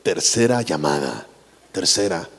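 very low, slow announcer voice; deep, formal stage-call voice; theater announcer. Acted. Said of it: "esas palabras me suenan así de".

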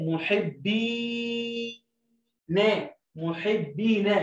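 محبي stretched out very slow; teacher stretching محبي; very slow blending. Shown as a demonstration. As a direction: draw out محبي for the class. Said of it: "الباء التانية مع المد بالياء".

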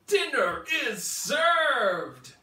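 big excited shout, slow; yelling out. A narrator's reading. Brother shouting to his brothers about food, loud and slow. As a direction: bellow it like a hungry dino. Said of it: "Rufus Tough yelled out".

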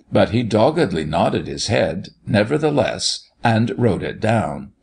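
plain reading voice

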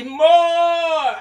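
high, loud drawn-out call. Acted. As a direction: urge further. Urging the snake on.

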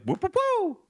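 very high squeaky whoop whoop whoop; whooping call. Retold or imitated. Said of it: "This is like".

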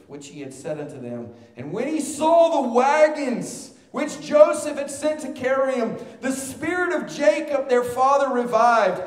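starts strong, soars loud and high at the climax; scripture read with building fervor. Acted. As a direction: let the joy of the news lift your voice. Reads verses, swelling to climax.

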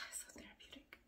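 soft unvoiced whisper; a relaxed sigh of a whisper. Real, not acted. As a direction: breathe it out, dreamy and relaxed. Whispering how filming relaxes her.

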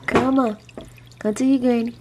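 a lighter touch of the high pet-talk; gentle call to a pet; coaxing granny voice. Acted. Coaxing the dog to come.

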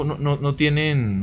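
usual speaking voice

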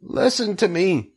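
high-pitched plea for attention; pressing, high-voiced appeal; urgent cry. A narrator's reading. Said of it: "cried Wilbur".

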